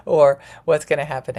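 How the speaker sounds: real laugh spilling into the words; amused at your own grim thought; laughing at oneself. Real, not acted. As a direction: burst out laughing, then carry on. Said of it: "I'm laughing again at myself".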